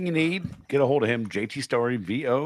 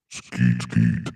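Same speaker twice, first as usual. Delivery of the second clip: much lower, pitched-down voice changer; 13 semitones lower, 4 dB louder than usual. Pretend. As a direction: drop it way down like a voice changer. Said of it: "let me get the voice changer".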